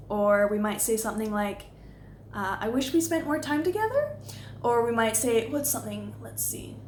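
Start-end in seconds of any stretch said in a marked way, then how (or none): 2.6-4.2 higher voice climbing to "together"; tentative uptalk, raised at the end on "together"; softening question inflection. Shown as a demonstration. Coach models softening a criticism, question inflection. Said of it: "soften their criticisms".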